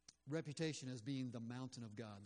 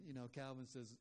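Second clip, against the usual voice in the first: usual speaking voice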